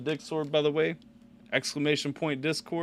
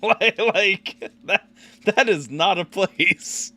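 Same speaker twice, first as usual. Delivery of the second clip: higher, louder, slowed for emphasis; loud slow emphatic protest; 7 semitones higher, 8 dB louder, much slower than usual. Real, not acted. Streamer insisting to the viewer that Newfoundland is not a place. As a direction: hammer it home slowly.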